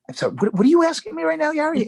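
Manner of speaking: high-pitched, quick, incredulous question; retold bafflement. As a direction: act out being thrown by the caller. Retold baffled reply to caller.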